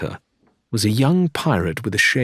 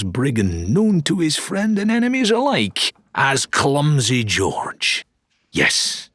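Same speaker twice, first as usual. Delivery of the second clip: showman dealer; 3 semitones higher, slower than usual. Acted. Dealer Wilbur pitching a blunderbuss, grandiose showman voice. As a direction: sell it like a carnival barker. Dealer tells Clumsy George tale.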